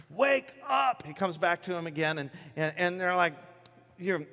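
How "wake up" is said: much higher, drawn-out acted call; insistent rousing. Jesus to disciples still asleep, more insistent acted urging. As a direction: press harder to rouse a heavy sleeper. Second, firmer try to rouse them.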